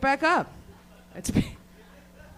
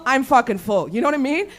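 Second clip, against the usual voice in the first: normal talking voice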